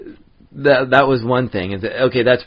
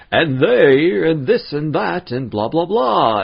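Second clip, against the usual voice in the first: higher, lightly projected mock actor chatter; projected stage voice; 6 semitones higher, 3 dB louder, slower than usual. Reenactors declaiming to a crowd, mock projected. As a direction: throw your voice like a stage actor. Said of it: "throwing your voice".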